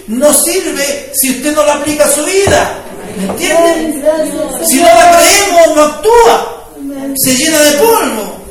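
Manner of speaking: loud, impassioned sermon voice; fervent preaching. Acted. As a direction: preach it out to the congregation. Preacher building to full force.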